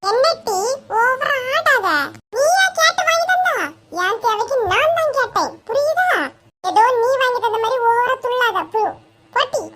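high-pitched sibling bickering; squabbling outburst. Acted. Brother telling sister not to get cocky, squabbling. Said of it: "கத்தாத".

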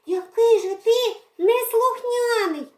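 high, loud, playful mock scolding; scolding girl. Girl playing mother scolds the naughty cat. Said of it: "картала Редьку Марійка".

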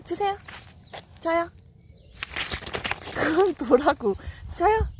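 high pleading pet voice; voicing the dog. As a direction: beg for the treat as the dog. Speaking for the dog.

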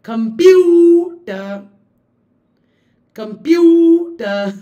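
slow Computer with a loud, high, stretched PU; exaggerated stress. Shown as a demonstration. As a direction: overdo comPUter with big mouth movement. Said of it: "It uses larger facial movements".

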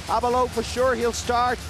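ordinary talk

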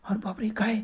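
hushed frightened gasp of worry; scared whisper. Retold or imitated. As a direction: breathe it out in alarm, barely voiced. Fearful thought about drunk friend. (घाबरून कुजबुज)

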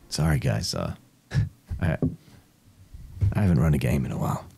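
ordinary talk, nothing marked

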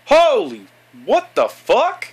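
loud, high shock; real high-pitched startled yell; startled outburst. Real, not acted. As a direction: blurt it out, pitch leaping up. Startled by game.

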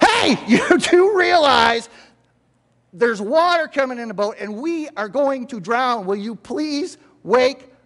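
high, drawn-out urgent yell; exasperated wake-up yell. Acted. Disciple yelling at sleeping Jesus as the boat floods, urgent. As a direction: yell as if rousing a sleeping teenager. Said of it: "you have to yell at them to wake up".

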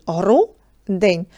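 гру-день split into two syllables, гру then день. Shown as a demonstration. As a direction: say гру-день with a clear break between the syllables. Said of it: "ділимо на склади". (гру-день по складах, з паузою між гру і день)

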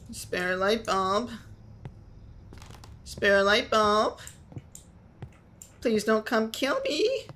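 high, drawn-out, wary singsong; nervous pleading singsong. Real, not acted. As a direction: plead slowly in a high voice, rising at the end. Nervous plea to unseen threat.

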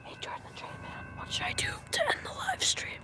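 whispering. Real, not acted. Whispering question during stream.

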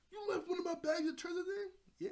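high, loud mock outrage; screech-pitched disbelief, acting out a character; indignant retold yell; outraged. Nami outraged at losing treasure.

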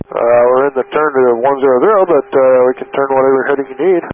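loud pilot over radio; busy cockpit radio call; air traffic control radio. Real, not acted.

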